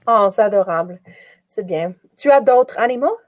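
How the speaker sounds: usual speaking voice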